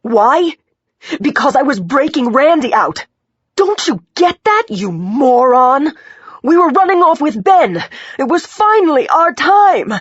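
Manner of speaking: high, loud, tearful rant; furious outburst. A narrator's reading. Injured woman berating the detective, furious outburst. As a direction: throw it at him loudly, voice climbing. Patient raging at the detective's question.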